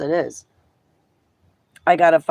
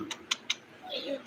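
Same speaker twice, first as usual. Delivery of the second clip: very high, soft, slow coo; 16 dB quieter than usual. Real, not acted. Asking the pet what it is doing, soft high baby talk. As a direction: coo it softly in a tiny high voice.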